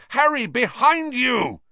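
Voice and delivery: high, loud anguished yell across the pitch. Acted. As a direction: yell his name in anguish from the goalposts. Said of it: "Wood's anguished yell".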